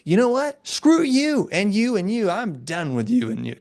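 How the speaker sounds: playful mock anger, pitched well up; mock defiant outburst. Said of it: "you might be like".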